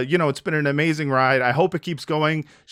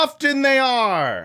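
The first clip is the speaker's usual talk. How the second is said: mock agreement; 11 semitones higher, 3 dB louder, slower than usual. Quip in high silly voice.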